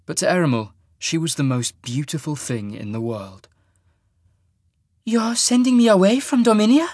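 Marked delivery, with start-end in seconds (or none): 5.1-6.9 high, loud exclamation with a hint of delight; exclaimed delight. A narrator's reading. Woman learns she is leaving Dorminia, high delighted exclamation. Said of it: "she exclaimed".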